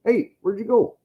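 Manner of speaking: high loud call. Real, not acted. Calling out to his friend Grant, high and loud. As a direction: call across the house to find him.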